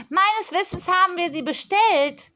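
put-on a pampered child scolding a supplier; spoilt girl on phone. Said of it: "fuhr das Mädchen dazwischen".

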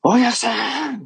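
high, long-drawn call to a customer; stretched-out, high-pitched mock shout; retold loud call. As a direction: imitate station staff calling out from afar. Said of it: "大きい声で".

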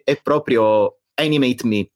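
ordinary talk, nothing marked

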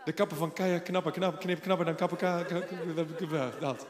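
stumbling through nonsense syllables while laughing; laughing tongue twister. Real, not acted. Preacher tripping over a tongue twister, laughing. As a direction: fumble the words and crack up. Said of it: "tongue twister".

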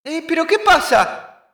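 high shrill panicked pleading; panicked high yelling. Acted. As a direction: plead for mercy while being hit. Being punched, pleading shouts.

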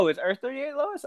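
high, soft, surprised rising question; realizing you were wrong. Real, not acted. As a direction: catch yourself, surprised.

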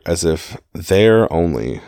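"there" drawn out slowly for emphasis; stress on THERE. Shown as a demonstration. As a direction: lean slowly on the word "there". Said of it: "I kinda tried to emphasize it".

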